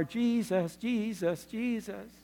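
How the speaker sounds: imitated hushed, lifted worship; soft mock praising. Imitating praise from the car's back.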